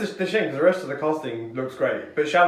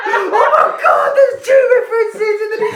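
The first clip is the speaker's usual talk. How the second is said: squealing high excited shout; 9 dB louder, slower than usual. Retold or imitated. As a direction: shriek with laughing excitement. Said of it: "you were like".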